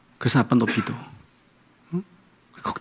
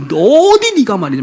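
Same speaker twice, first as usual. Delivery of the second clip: high, loud, slow scolding; mock scolding; 9 semitones higher, 5 dB louder than usual. Scolding parent to child, high, loud and slow. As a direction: wag the finger at the kid.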